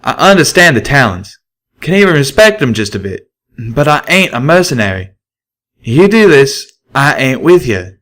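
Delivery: firm stallion ultimatum; Calamity. A narrator's reading. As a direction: draw a line in the sand. Calamity refuses to join.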